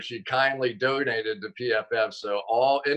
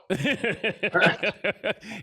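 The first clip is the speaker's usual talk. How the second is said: high, sustained laugh; 10 semitones higher than usual. Real, not acted. Co-host cracking up at the guest's answer, high laugh. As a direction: burst into a long laugh. Amused by the joke.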